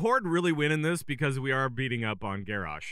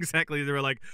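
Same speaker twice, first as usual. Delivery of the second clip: normal talking voice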